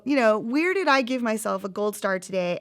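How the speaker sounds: ordinary delivery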